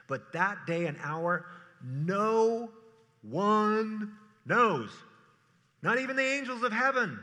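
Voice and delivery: loud, high preaching of the verse; solemn scripture reading. Acted. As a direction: proclaim the verse with gravity. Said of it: "Matthew twenty-four thirty-six says".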